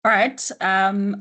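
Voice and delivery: usual speaking voice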